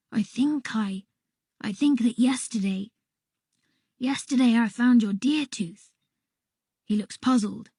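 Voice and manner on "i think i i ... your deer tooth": usual speaking voice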